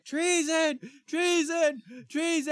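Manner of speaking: high, loud cries of alarm; shouting. Retold or imitated. A crowd raises the alarm in the camp, shouting. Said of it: "a bunch of people were shouting".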